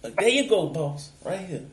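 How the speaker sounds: high-pitched, eager-to-please put-on voice; mock servile driver. Driver showing papers to police, mock servile and high. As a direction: play overly obliging to an officer. Trying out the coached servile act.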